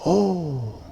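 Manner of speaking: soft amazed oh with hand over mouth; a hint of an impressed gasp; awed oh. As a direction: breathe out an astonished oh. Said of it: "se impresiona y se coloca la mano en la boca y dice".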